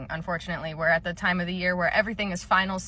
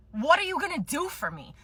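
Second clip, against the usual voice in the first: higher, entitled demand leaning on what; demanding customer; 7 semitones higher than usual. Acted. Demanding customer pressing the clerk, entitled whiny demand. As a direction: push it like you're owed something. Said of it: "I fucking hate when people say that to me".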